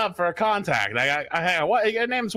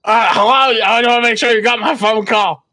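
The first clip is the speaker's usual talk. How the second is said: much louder, higher phone voice; loud phone hello; 5 semitones higher, 12 dB louder than usual. Pretend. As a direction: shout into the phone to check the call. Calling the man standing beside him.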